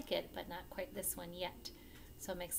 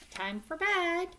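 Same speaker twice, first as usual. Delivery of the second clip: higher, louder bedtime call; mock parental call; 9 semitones higher, 10 dB louder, slower than usual. Parent tells dinosaur bedtime.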